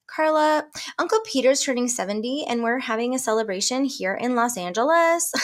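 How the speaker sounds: a hint of a Valley Girl caller; Valley Girl accent. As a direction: play the cousin as a bubbly Valley Girl. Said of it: "That's my Valley Girl accent".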